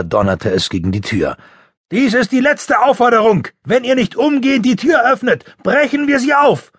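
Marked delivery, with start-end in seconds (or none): 1.9-6.7 much higher, louder policeman voice, muffled through the door; policeman's final warning. A narrator's reading. Policeman outside threatens to break in, loud and muffled.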